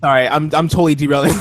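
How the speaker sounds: normal talking voice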